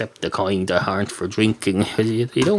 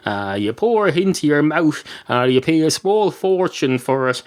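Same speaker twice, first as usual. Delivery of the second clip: normal talking voice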